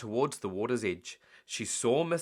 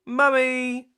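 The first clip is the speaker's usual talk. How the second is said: put-on high, loud child's call; shouting; 12 semitones higher, 8 dB louder than usual. Peppa calling Mummy down to breakfast, high shout. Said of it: "shouted Peppa".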